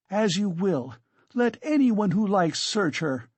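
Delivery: high, defiant exclamation; crying out. A narrator's reading. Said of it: "cried Katerina Ivanovna".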